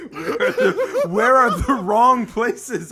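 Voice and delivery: high, slow, laughing squeals; can barely talk from laughing; laughing hysterically. Real, not acted. Cracking up at the joke.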